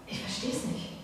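soft breathy whisper of disbelief; David whispering his bewilderment at the soldiers. Acted. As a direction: whisper it to yourself.

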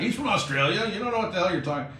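insist, brushing the caller off; retold emphatic rebuttal, speeding up; emphatic insistence. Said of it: "and I'm emphatic".

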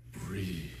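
calm breathe. Retold or imitated. Imitating Jorge Torres telling a dancer to breathe. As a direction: tell them to breathe like a calm teacher. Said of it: "He's like".

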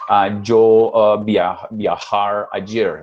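halting, very slow voice with hesitations; broken Spanish foreigner. Retold or imitated. Teacher imitating a foreigner who misuses the past. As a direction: stumble like a foreigner struggling with Spanish. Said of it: "Es como si usted estuviera hablando así".

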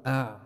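أع squeezed from the middle of the throat; throat letter demo. Shown as a demonstration. Teacher to class, sounding أع from mid-throat. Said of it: "بتلاحظ نزل الصوت شوي".

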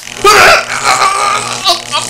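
high, loud scream of pain; pained cry. Acted. Tortured witness crying out, loud scream. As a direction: cry out as it hurts. Witness being hurt, cries out.

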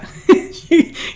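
unvoiced delighted laugh; real surprised laugh; breathless laugh. Real, not acted. Laughs at host's guess.

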